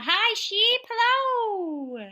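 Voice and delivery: high, loud hello to sheep; reading to a toddler. Acted. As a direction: greet it like for a toddler. Reading to little kids.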